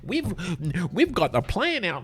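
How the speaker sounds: high, loud mocking whine of a solar booster; mock renewables advocate. Mocking solar fans defending recycled panels, high sneering whine.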